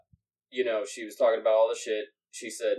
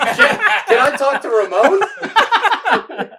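high, slow, squeezed out between laughs; can barely get the words out for laughing; laughing through it; 13 semitones higher, 12 dB louder, much slower than usual. Real, not acted. Asking the saleswoman for Ramon, cracking up. Laughing while quoting himself.

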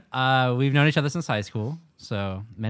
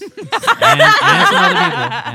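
laughing; 13 dB louder, much slower than usual. Real, not acted. Laughing teasing reveal.

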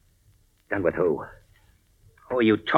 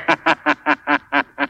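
a taunting laugh from out of nowhere; mocking laugh; 4 semitones higher than usual. The Shadow laughs at Mister X from the darkness. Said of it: "Who laughed?".

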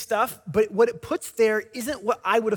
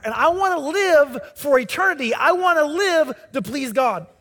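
fervent preaching; 3 semitones higher, 4 dB louder than usual. Acted. Preacher telling the congregation what truly matters, fervently. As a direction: declare it with building conviction.

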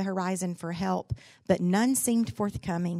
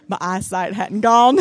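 laughing; 12 dB louder than usual. Real, not acted. Preacher's wife retelling the joke, laughing. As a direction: laugh as you say it.

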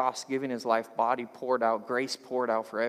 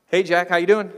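loud, higher phone greeting; hailing on phone; 6 semitones higher, 10 dB louder than usual. Retold or imitated. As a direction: call out a big hearty hello. Calling stepdad on the phone.

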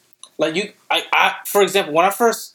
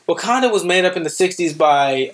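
normal talking voice

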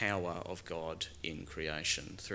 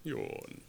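big low yawn, much softer; sleepy yawn; 10 semitones lower, 8 dB quieter than usual. Acted. Jesus stretches before sleeping, soft low yawn. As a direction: act out a lazy stretch-yawn.

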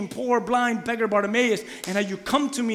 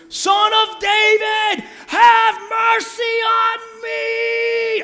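long, slow, sung-out high cry; crying out louder; 10 dB louder, much slower than usual. Retold or imitated. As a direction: stretch the plea out as loud as you can. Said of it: "he cried out even louder".

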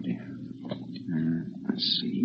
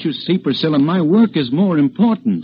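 exasperated protest; 8 semitones higher than usual. Acted. Marital quarrel.

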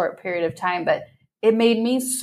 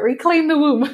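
slogan laughed out; 7 semitones higher than usual. Shown as a demonstration. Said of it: "that should be a new shirt".

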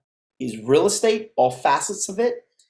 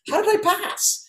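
high, squeaky disbelief; incredulous. Acted. Telling how he scraped through school, incredulous. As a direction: ask it in high-pitched amazement. Amazed that he passed summer school.

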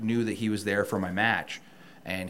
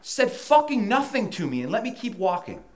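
higher, indignant, venting; heated rant; 12 semitones higher, 5 dB louder than usual. Real, not acted. Ranting to the host about the company's empty promise, heated and high. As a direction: let the frustration boil over.

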